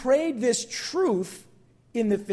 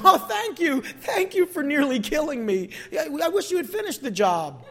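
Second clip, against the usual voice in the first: sarcastic high thank-you, half laughing; mock gratitude; 5 semitones higher than usual. Preacher mocks a suicidal driver thanking the offender, sarcastic. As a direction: thank him with ironic sweetness. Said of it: "I'd have been".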